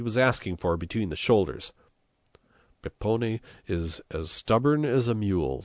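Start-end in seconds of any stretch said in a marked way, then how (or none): none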